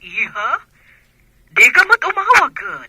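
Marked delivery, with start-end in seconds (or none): 1.5-2.9 higher and louder, a mother's reproach; mild scolding. Acted. Mother on the phone to her daughter, scolding loudly. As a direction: chide her for never calling.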